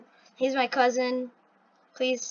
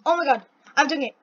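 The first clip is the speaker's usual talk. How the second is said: normal talking voice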